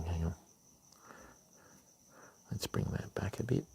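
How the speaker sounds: soft whispered hang on, barely voiced; whispered to himself. Real, not acted. Streamer fixing a lure, whispering to himself. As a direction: breathe it under your breath while fiddling with the lure.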